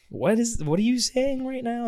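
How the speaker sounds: high, disbelieving question; retold student baffled by the teacher; incredulous student. Student challenging religion teacher.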